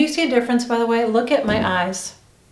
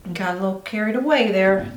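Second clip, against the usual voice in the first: southern accent. Real, not acted. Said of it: "talking with a southern accent".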